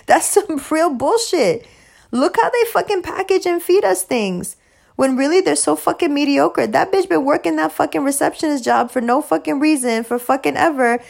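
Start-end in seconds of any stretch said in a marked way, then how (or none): none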